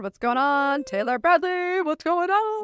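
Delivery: high, hyped-up bro greeting; broski hype greeting. Acted. Host hyping up her co-host, bro-style greeting. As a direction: pump the crowd like a bro host. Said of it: "I got so broski".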